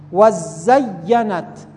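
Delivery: very slow, each syllable drawn out; slowly sounded out. Shown as a demonstration. Teacher dictating the word to a student, very slow. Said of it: "دو تا تشدید داره".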